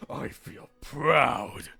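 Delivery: long-drawn, swelling "proud"; slow, savored declaration of pride; mock proud. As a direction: stretch it out with showy pride.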